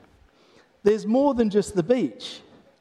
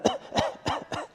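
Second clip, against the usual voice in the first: short airy cough of something down the wrong way; a light, breathless little cough with a laugh; dainty wrong-pipe cough; small sip cough; 7 dB quieter than usual. Shown as a demonstration. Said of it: "The first one's like".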